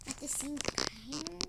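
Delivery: hushed scared whisper; nervous whispered aside. Real, not acted. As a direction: lean in and whisper to the viewers.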